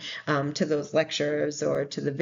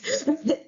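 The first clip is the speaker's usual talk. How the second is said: short laugh; 9 semitones higher than usual. Real, not acted. Laughs at own abstinence joke.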